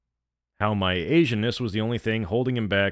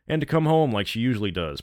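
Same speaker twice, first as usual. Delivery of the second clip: plain reading voice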